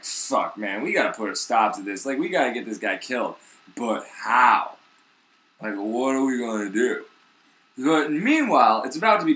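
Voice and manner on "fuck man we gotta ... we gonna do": low, hushed scheming voice; plotting Pharisees. Retold or imitated. Pharisees scheming in a huddle, low and hushed. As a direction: mutter it like conspirators plotting murder.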